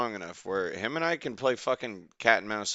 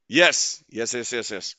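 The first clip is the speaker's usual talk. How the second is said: excited; 8 dB louder than usual. Real, not acted. Fish finally hooked.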